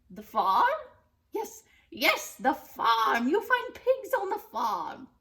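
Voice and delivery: loud, high gleeful witch; excited witch. Acted. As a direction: pounce on the answer. Witch decides.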